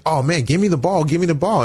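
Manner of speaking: put-on a young player itching to get the ball; eager pleading. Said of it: "And I would be like".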